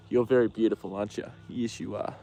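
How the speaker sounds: soft, cooing to the horse; soft pet talk. Real, not acted. Owner praising her beautiful horse, softly cooing. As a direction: coo it gently, like praising a pet.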